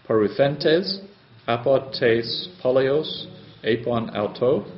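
slowly, word by word, each Greek word spelled out; slow careful reading. Shown as a demonstration. Teacher to class, slow careful Greek reading. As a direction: read it slowly for the students. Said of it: "Greek sentence reread slowly for students".

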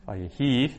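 Vayehi with HI stressed; stress on final syllable. Shown as a demonstration. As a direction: lean on the last syllable of Vayehi. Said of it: "which syllable you put the stress on".

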